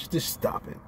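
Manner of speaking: voiceless pleading to stop it; exasperated whisper. Real, not acted. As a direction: breathe it out, fed up. Scolding a pundit, whispered take.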